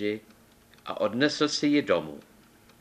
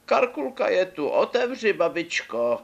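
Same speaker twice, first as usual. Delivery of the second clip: put-on the wolf squeaks like the little girl; wolf faking a girl's voice; 10 semitones higher than usual.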